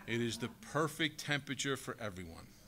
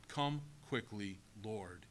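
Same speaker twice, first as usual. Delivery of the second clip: much softer, slow, sincere prayer; heartfelt plea; 5 semitones lower, 8 dB quieter, slower than usual. Acted. A believer longing for the Lord, soft sincere plea.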